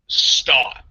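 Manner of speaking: a loud, emphatic STOP; retold firm command, Stop hit hard. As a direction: punch out Stop as one forceful word. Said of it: "again, very emphatically".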